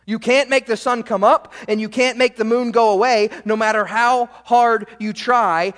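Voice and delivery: usual speaking voice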